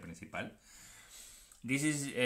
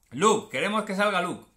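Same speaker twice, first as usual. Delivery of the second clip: excited calling; 6 semitones higher, 9 dB louder than usual. Real, not acted. Hoping for a card while opening.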